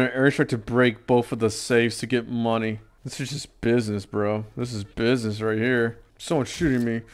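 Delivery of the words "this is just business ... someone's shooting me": ordinary talk, nothing marked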